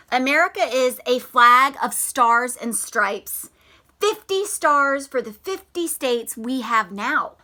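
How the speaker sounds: story reading. Acted. Reads picture book aloud.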